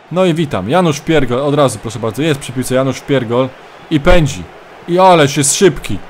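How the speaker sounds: energetic play-by-play commentator voice; live match commentary. Acted. Mock football commentary of own match.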